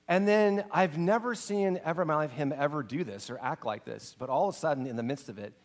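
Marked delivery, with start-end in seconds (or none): none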